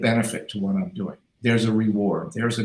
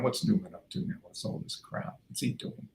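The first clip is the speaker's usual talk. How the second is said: muttered under the breath, much softer; muttering staff; 11 dB quieter than usual. Retold or imitated. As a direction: grumble it so the boss can't hear. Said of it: "they're going".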